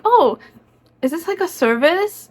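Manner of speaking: bright, high curious question; surprised question. Retold or imitated. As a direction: ask it lightly, pitched well up.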